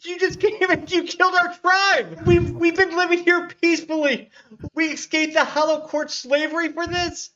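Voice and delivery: very high, loud, outraged goblin cry; furious goblins. Acted. Goblin survivors scream at the party who killed their tribe, outraged. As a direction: shriek your accusation at the intruders. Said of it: "They're almost looking at you like".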